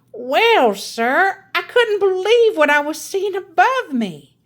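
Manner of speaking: a grandmother topping her sister's story; Grandma's storytelling voice. Acted. Grandma tells her tale.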